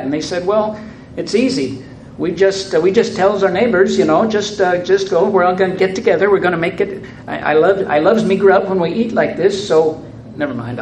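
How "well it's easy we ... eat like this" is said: put-on Newfoundland accent. Newfoundland mayor explains the cookout, in a hammed-up Newfoundland accent. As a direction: play the cheerful Newfoundland townsman. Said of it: "I'll lapse out of Newfoundland".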